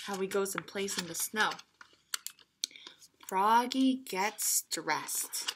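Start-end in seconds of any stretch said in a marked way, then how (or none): 3.3-5.5 whispered softly; hushed whisper. A narrator's reading. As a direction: breathe the words. Reading aloud, whispered aside.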